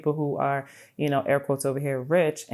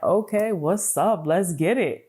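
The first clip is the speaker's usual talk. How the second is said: hype universe; 4 semitones higher, slower than usual. Retold or imitated. Universe voiced as hype friend.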